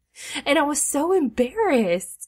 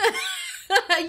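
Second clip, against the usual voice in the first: high laugh; 10 semitones higher than usual. Real, not acted. Laughing at teasing.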